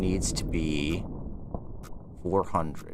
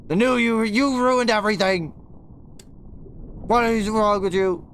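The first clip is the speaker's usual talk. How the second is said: frustrated yell; 11 semitones higher than usual. Real, not acted. Streamer yelling at the game that ruined everything, furious. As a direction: yell it like the game betrayed you.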